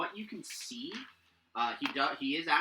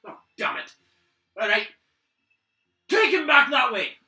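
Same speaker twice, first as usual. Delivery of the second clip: curse, then loud high shouted command; frustrated order; 13 semitones higher, 16 dB louder than usual. Acted. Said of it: "turn over and go".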